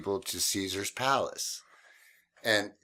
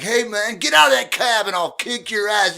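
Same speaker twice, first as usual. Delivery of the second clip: loud, high angry threat; threatening yell; 12 semitones higher, 9 dB louder than usual. Retold or imitated. Man outside cab shouting threats at drunk passenger. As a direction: challenge him to come out.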